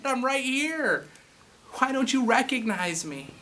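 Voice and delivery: a hint of Jesus, slow and earnest; Jesus insisting he is present; Jesus pleading. Voicing Jesus, full voice.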